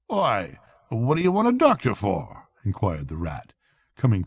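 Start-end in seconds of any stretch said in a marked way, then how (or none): 0.1-2.5 higher, startled question; taken aback by the request; puzzled Rat. A narrator's reading. Rat asking Toad why he needs a doctor, startled.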